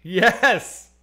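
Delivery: drawn-out, high shouted answer; very loud, high reply to a deaf line; exasperated shout. Real, not acted. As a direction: yell it into the phone. Caller asks again if heard.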